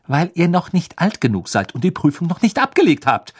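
irritated, emphatic telling-off; snapping angrily. A narrator's reading. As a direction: snap back like a cross mother. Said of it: "fauchte Misses Weasley".

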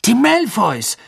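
high, excited outburst; sudden loud cry. A narrator's reading. Ron suddenly shouts so the cave echoes, loud cry. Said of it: "rief Ron plötzlich so laut".

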